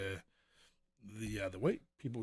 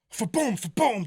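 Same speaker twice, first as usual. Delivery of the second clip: loud double fa-boom, second leaping higher; imitated booms; 10 semitones higher, 9 dB louder than usual. Storyteller imitates two more shooting out, loud booms. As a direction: fire off two explosive sound effects.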